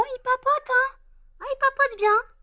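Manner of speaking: mock him playfully in a high voice; teasing. Real, not acted. Teasing about Emmanuel who chats a lot, high and sing-song.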